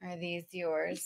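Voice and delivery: deadpan disapproving question; stern stewardess voice. Retold or imitated. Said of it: "clears throat, retells stewardess".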